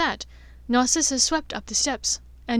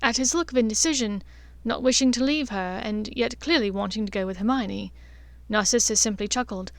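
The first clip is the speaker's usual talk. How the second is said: usual speaking voice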